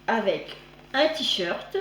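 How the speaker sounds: normal talking voice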